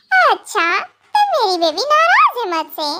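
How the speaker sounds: usual speaking voice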